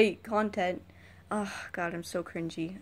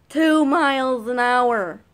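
louder, slow repeat of the speed; exasperated shout; 4 semitones higher, 10 dB louder, slower than usual. Real, not acted. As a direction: blast it out in disbelief. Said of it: "road rage repeat".

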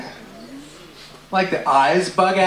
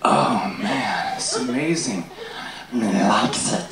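creeping Gollum voice; 7 semitones lower, 4 dB quieter, slower than usual. Retold or imitated. Meth user turning Gollum.

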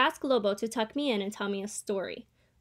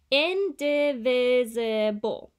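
"Indivisible" drawn out very slowly and louder, syllable by syllable; slow teaching demo; 2 semitones higher, 5 dB louder, much slower than usual. Acted. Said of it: "My teacher points to one of the words".

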